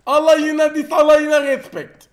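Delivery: loud, slowed triumphant brag; hype boast. Real, not acted. Streamer bragging about his kills, loud and triumphant. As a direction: stretch it out like a victory cry. Gamer brags after kills.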